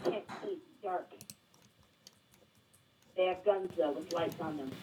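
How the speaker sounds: low, soft, tense report; quiet, wary lookout voice; hushed fear. Acted. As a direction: keep it down so pursuers can't hear. Hiding in dark from armed pursuers.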